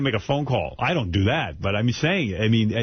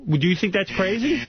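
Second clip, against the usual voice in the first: ordinary talk, nothing marked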